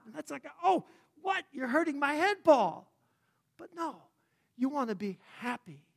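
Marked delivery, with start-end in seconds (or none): 0.6-2.9 high, bewildered what?; mock bewilderment. Listener baffled by Paul's advice, mock bewildered. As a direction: play the baffled listener, hammed-up. Mock confused reply to Paul.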